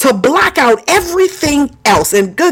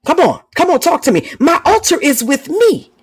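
usual speaking voice

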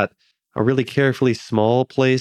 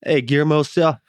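mock Southern; 5 semitones higher than usual. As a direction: imitate the Southern accent, then give up. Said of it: "He was real deep in Southern".

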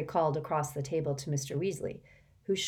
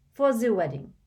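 put-on French accent; 6 semitones higher, 6 dB louder than usual. Fleur tells Harry about his wedding disguise, French accent. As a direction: speak with a light French lilt.

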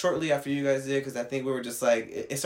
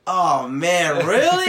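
imitated incredulous whine, climbing sharply; mock dismay; 2 semitones higher, 7 dB louder, much slower than usual. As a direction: cry out in exaggerated disappointment. Mimics friend's dismay overheard through door.

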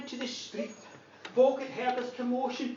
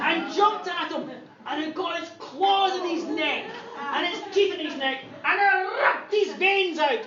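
ordinary talk, nothing marked

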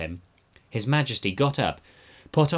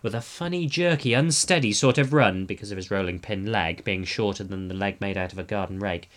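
plain reading voice

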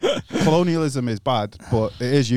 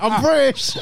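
laughing retelling; 14 semitones higher, 5 dB louder, slower than usual. Retold or imitated. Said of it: "It's like".

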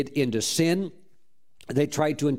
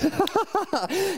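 short high burst of laughter. Real, not acted. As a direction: laugh high and bright at the punchline. Laugh after the punchline.

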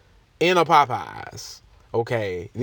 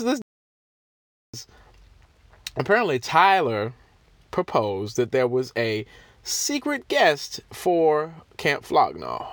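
ordinary talk

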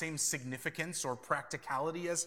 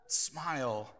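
usual speaking voice

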